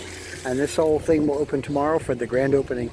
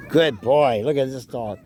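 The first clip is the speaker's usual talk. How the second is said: ordinary talk, nothing marked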